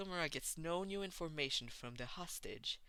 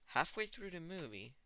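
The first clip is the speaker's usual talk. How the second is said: ordinary delivery, nothing marked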